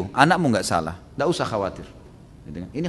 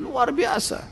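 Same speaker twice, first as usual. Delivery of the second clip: excited praise; 11 semitones higher, 4 dB quieter, much slower than usual. Acted. Praising the just caliph.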